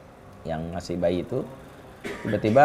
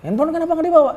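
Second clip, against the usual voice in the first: high, louder reproach; angry scolding; 4 dB louder, faster than usual. Retold or imitated. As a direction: scold her for leaving the phone. Husband scolding wife. (omelan marah, tinggi dan keras)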